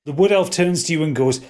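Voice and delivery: ordinary talk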